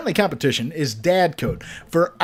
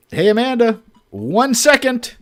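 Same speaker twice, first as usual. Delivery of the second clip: calling out; 7 semitones higher, 4 dB louder than usual. Acted. Dad calling to daughter.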